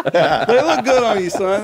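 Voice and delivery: high, loud, emphatic praise; excited compliment. Real, not acted. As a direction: hype him up with a big compliment. Hyped compliment.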